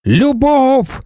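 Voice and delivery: high, fervent one-word cry; crowd's shout. A narrator's reading. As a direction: shout it up high like adoring followers. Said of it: "закричали вони".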